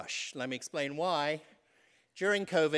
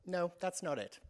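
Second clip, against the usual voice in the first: shop clerk saying no; 4 semitones higher, slower than usual. Retold or imitated. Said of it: "she looked at me and she went".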